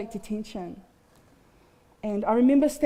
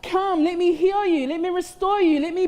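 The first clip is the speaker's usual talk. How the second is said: higher, louder fervent call; preaching at full force; 7 semitones higher, 4 dB louder than usual. Real, not acted. Preacher urging the congregation to come, at full force. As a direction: plead come, come, come.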